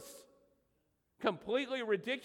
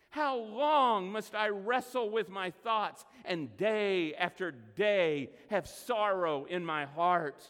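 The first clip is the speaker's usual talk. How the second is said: loud, slow, pleading lament; a desperate plea read with full force; anguished psalm reading; 5 dB louder, slower than usual. Acted. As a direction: cry out each how long to God. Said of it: "He comes to God and he says".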